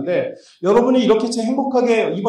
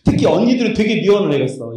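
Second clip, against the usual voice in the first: ordinary talk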